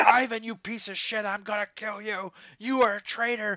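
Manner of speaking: loud, high raging at the traitor; furious threats. Acted. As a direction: scream abuse at Ivan. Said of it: "the other guy's like".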